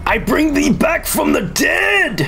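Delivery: high slow booming decree; grand proclamation. Acted. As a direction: proclaim like a god.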